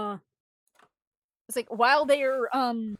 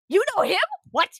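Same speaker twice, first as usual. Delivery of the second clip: louder, much higher incredulous question; mock-shocked, pitched up and loud; astonished repeat; 9 semitones higher, 5 dB louder, much faster than usual. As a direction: burst out in acted disbelief.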